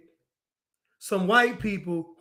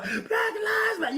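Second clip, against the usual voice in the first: much higher, laughing mock shout of the chant; mock protest shout; 12 semitones higher, 5 dB quieter than usual. Protesters outside the building, hammed-up laughing shout. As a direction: squeal the chant half-laughing.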